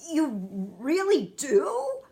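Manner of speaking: light up with hopeful joy; a giant thrilled by a compliment; brightening. A narrator's reading. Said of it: "suddenly brightening".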